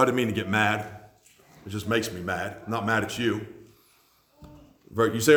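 usual speaking voice

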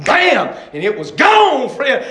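punchy testimony with explosive shouted words; forceful revival-preacher delivery; full-force preaching. Real, not acted. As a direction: preach it hard, bursting out on the key word.

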